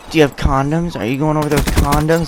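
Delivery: nagging mom. Retold or imitated. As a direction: lightly mimic a mom's prying questions. Retelling mom's awkward questioning.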